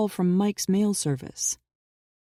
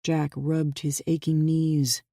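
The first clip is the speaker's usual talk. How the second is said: usual speaking voice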